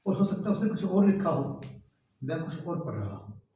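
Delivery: normal talking voice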